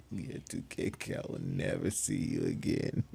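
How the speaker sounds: amused, voice half lost in laughs; laughing. Real, not acted. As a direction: try to talk through a chuckle. Laughing at a viewer's joke.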